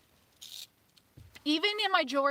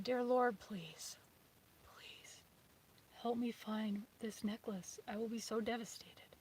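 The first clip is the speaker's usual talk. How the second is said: softer, voiceless little prayer; whispered prayer; 9 dB quieter than usual. Retold or imitated. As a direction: plead quietly in a whispered prayer. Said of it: "I said a little prayer".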